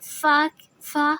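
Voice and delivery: "فا" with an audible puff of air. Shown as a demonstration. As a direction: blow the f of "فا" like putting out a candle. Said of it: "پھونک کی سی آواز". ("فا" پھونک کی آواز کے ساتھ)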